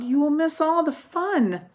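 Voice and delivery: pleading cry. A narrator's reading. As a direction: plead with him not to sleep. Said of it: "cried Frog".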